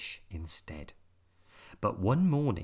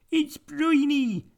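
very high, louder disgusted squeak; squeaky pirate voice; 8 dB louder, slower than usual. A narrator's reading. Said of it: "said Peg Polkadot".

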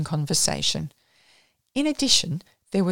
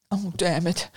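ordinary delivery, nothing marked

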